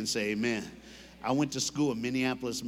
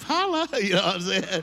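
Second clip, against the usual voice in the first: laughing holla; 5 semitones higher, 5 dB louder than usual. Retold or imitated. Showing off from the sunroof.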